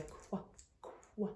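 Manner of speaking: soft qu, qu as a clue; hinting qu sound. Shown as a demonstration. Teacher prompting the kids with qu. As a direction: give the first sound as a hint. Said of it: "makes a sound of qu".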